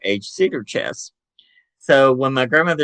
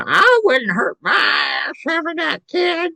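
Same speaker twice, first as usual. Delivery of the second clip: much higher, slow, whiny mock of a spiteful crone; nasty old grandmother. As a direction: sneer it like a bitter old woman. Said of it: "she's going".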